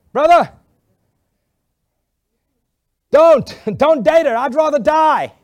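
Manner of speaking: loud, high, laughing plea; shouting plea. Retold or imitated. Pastor shouting at the boy not to date her, loud laughing plea. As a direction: yell it across the room, half laughing.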